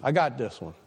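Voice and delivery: lightly voiced cocky brush-off; a hint of smug independence; mock self-assured. Believer brushing God off, mildly cocky. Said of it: "we say to God".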